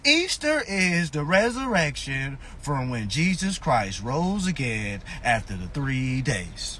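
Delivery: slow, low, mock recital of doctrine; know-it-all churchgoer. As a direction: imitate someone solemnly reciting Bible study, voice sinking. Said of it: "Then they be like".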